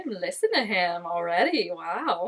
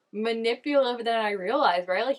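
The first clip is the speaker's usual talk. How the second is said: ordinary delivery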